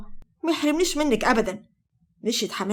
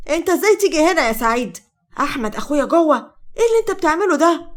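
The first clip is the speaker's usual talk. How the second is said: sharp, higher and louder challenge; 5 semitones higher, 4 dB louder than usual. A narrator's reading. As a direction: confront him with rising indignation. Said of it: "وقالت بحدة". (بحدّة)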